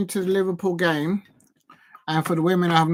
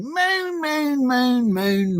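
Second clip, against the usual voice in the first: mock moaning; 7 semitones higher, much slower than usual. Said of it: "they'll be moaning".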